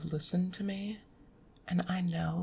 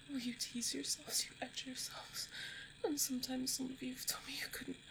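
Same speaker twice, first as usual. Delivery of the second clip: soft, higher, airy intimate voice; hushed and higher, close to the listener; aroused murmur; 5 semitones higher, 6 dB quieter than usual. Acted. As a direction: half-whisper it as arousal builds. Intimate performance, arousal building.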